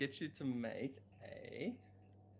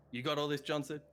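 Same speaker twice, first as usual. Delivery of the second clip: a lightly voiced boss calling to his clerk; a hint of a gruff guard captain; 5 semitones higher, 4 dB louder than usual. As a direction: call across to the note-taker.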